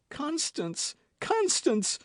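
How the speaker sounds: very high, rising anguished cry. A narrator's reading. D'Artagnan as Constance dies, anguished cry. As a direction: cry out her name in grief. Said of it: "cried D'Artagnan".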